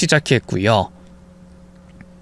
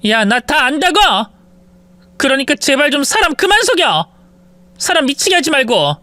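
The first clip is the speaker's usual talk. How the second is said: high, pleading outburst; exasperated yell; 9 semitones higher than usual. Acted. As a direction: stop lying to me, fed up. Wife yells at husband.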